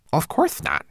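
high little manservant voice; fussy tiny homunculus. Acted. Twigleg speaks.

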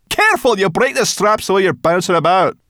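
high, loud scolding; cross. A narrator's reading. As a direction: snap crossly over the din. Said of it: "said Sorrel crossly".

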